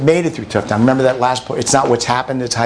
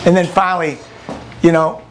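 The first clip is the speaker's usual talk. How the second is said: usual speaking voice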